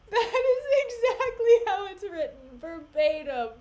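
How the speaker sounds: laughing. Real, not acted. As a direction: crack up while insisting it's true. Laughing at the text.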